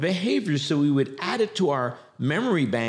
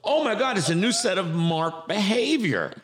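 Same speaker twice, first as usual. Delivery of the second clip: higher, excited gasp; mock astonishment; 8 semitones higher than usual. Friends reacting to sober Mark, amazed. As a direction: act amazed at the news. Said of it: "then we go".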